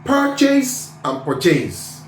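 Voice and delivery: PURCHASE slowly twice, high first, stress changed; contrasting word stress. Shown as a demonstration. Teacher showing they differ, PURCHASE stressed two ways. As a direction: move the beat within PURCHASE on the repeat. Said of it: "They are not the same".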